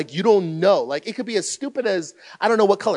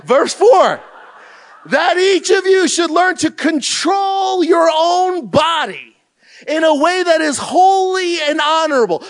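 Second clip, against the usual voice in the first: higher and louder, slow at the start; bold reading aloud; 8 semitones higher, 5 dB louder than usual. Retold or imitated. Pastor reading over the mumbling counselees, loud and bold. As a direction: read the verse right at them.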